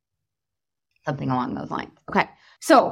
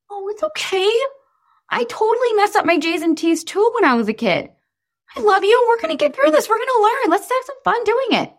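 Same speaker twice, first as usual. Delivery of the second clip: put-on much higher, sweet reassuring mom voice to a child; soothing parent; 10 semitones higher, faster than usual. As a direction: comfort a struggling kid gently.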